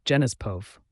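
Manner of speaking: usual speaking voice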